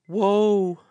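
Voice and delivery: high, loud, breathless wail of doom; panting cry of woe. A narrator's reading. Exhausted courier crying woe to the council. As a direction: gasp it out like a spent runner crying disaster. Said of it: "he panted".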